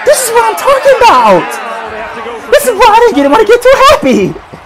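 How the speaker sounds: high, despairing complaint at the team; exasperated wail. Real, not acted. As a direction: wail it high, dropping at the phrase ends. Ranting at team's defensive failure.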